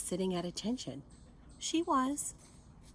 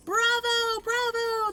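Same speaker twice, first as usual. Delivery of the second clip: much higher, louder cheering call; loud high bravo cheer; cheering crowd; 7 dB louder, slower than usual. A narrator's reading. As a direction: cheer like a thrilled audience. Students cheering.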